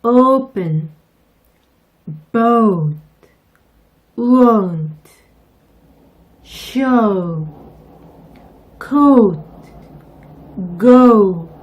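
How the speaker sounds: "open", "boat", "won't", "show", "coat", "go" loud with o gliding 오우; loud clear drill words; teacher models the 오우 glide; model oh-oo diphthong. Shown as a demonstration. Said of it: "단어 연습 몇 개만 해볼게요".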